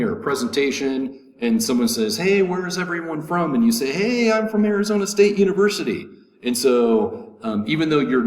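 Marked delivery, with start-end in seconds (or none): 2.2-3.5 higher-pitched mock call to a crowd. Acted speaker calling to a crowd. 3.9-6.1 high, loud, quick mock shout-out; calling out. Acted audience member shouting back.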